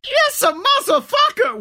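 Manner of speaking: high, distant yell; far-off yell. Retold or imitated. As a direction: yell it from afar. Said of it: "as you hear".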